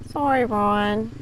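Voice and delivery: cooing apology. Real, not acted. Cooing to scared dog.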